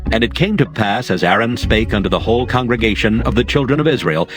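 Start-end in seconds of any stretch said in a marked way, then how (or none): none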